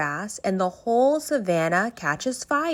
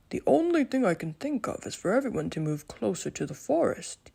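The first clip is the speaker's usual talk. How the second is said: calm, wise lion voice; a thoughtful king reassuring his friends; Leo the lion; 3 dB quieter than usual. Acted. Leo continues.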